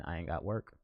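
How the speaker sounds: ordinary talk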